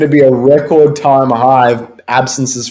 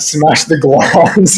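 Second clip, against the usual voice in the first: ordinary talk, nothing marked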